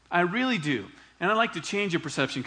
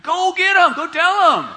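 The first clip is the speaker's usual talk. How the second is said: loud, high, pumped-up send-off; excited Jesus; 14 semitones higher, 12 dB louder than usual. Pretend. Jesus sending John's disciples off with a football pat, excited and loud. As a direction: cheer them on like a coach. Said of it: "He was excited".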